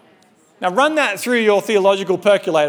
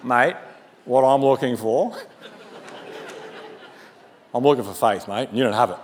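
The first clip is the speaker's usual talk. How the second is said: mock Australian Lord, much lower and softer; Australian accent; 6 semitones lower, 3 dB quieter than usual. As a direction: play the Lord as a laid-back Australian bloke. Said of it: "It's the Australian Bible".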